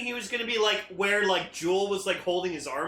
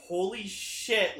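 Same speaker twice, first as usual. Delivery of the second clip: ordinary delivery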